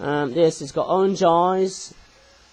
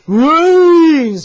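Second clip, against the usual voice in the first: loud, high zombie moan of brains; Patrick as a zombie; 9 semitones higher, 13 dB louder than usual. Retold or imitated. Toy reviewer voicing a zombie starfish, loud zombie moan. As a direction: groan it out like a hungry zombie. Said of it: "did Patrick's voice and going".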